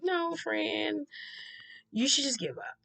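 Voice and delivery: mock advice. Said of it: "Somebody's like".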